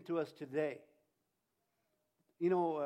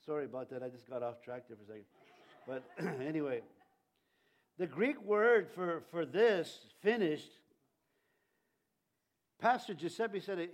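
ordinary talk, nothing marked

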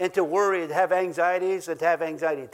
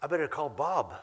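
laughing through a mock worry; teasing, laughing aside; mock worried laugh; 4 semitones lower, 6 dB quieter than usual. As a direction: joke it with a chuckle. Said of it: "Don't just say".

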